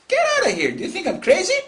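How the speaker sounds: high, loud, explosive retort; angry outburst. Acted. Enraged man rebuffing advice, acted furious outburst. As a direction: blow up in the adviser's face. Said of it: "The guy blew in his face and said".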